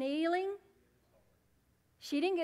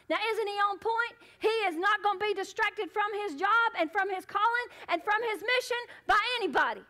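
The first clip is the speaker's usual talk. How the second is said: raised, driving pulpit voice; forceful preaching; 5 semitones higher, 6 dB louder than usual. Real, not acted. Preacher to congregation on Jesus's mission, forceful preaching. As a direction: preach it higher and louder, pressing each point home.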